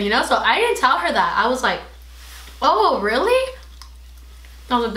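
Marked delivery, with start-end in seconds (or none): 2.6-3.5 fake surprise. Feigning surprise to the girl.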